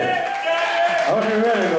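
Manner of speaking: high, slow hype call; drawn-out party hype to wedding guests; hyping the crowd. Real, not acted. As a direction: stretch it out to pump up the room.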